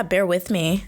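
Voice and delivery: ordinary talk, nothing marked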